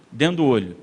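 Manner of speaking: usual speaking voice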